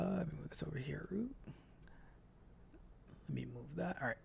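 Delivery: barely audible half-whisper; muttered aside. Real, not acted. Host moves a window on screen, muttering to himself. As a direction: trail along softly under your breath.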